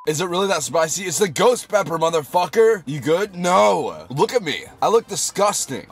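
high, worked-up whine at doubters; indignant protest. Real, not acted. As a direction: protest that it really is that bad. Arguing with doubting viewers.

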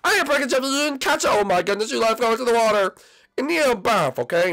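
high-pitched, heated blaming; upset protest. Acted. Junior blames Jeffy for the broken Switch, upset.

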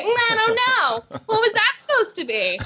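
very high, mock-doubtful nitpicking; playfully dish criticism back at a colleague; teasing critique. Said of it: "dish it right back to Neil".

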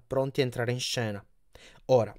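ordinary delivery

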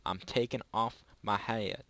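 usual speaking voice

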